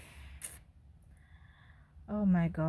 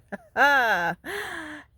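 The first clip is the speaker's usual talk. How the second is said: loud high laugh; 8 semitones higher, 7 dB louder than usual. Real, not acted. Laughing after his treasure.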